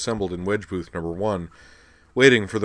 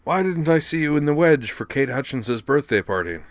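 higher-pitched accusing question; demanding; 6 semitones higher, 3 dB louder than usual. A narrator's reading. Student questioning his roommate about the party, demanding. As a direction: confront him for missing the party.

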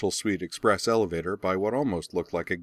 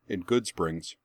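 usual speaking voice

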